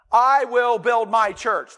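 loud, slow, each word separate; slow, ringing quote; weighty declaration. Shown as a demonstration. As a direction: proclaim it firmly, word by word. Quotes the key phrase weightily.

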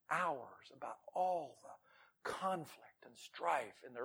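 soft, slow whisper. Acted. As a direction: lean in and whisper it slowly. Hushed aside on woman's call.

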